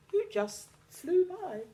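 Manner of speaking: high playful narration. A narrator's reading. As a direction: lift it high and light. Narration slips into fly.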